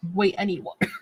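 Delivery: ordinary talk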